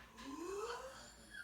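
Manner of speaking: high audible inhale; big showy gasp; teacher modelling a breath in; demo breath in. Shown as a demonstration. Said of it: "we're gonna take a deep breath".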